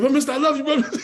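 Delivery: high, loud declaration with a laugh; retold gushing farewell to a mentor; affectionate outburst. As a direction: blurt out your affection, laughing.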